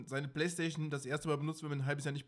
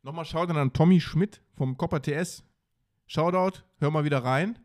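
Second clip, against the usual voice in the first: announcer shoutout; 3 semitones higher, 12 dB louder than usual. Acted. Radio-style shoutout to a listener.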